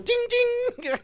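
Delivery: put-on high, squeaky little-girl chime; excited kid imitation. Dad repeating his daughter's excited chant in a squeaky voice. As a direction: chirp it like a delighted child. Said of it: "she got so excited".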